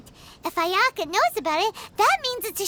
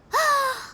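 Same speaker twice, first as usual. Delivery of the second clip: excited gasp; 4 semitones higher than usual. Acted. Realizes they may marry.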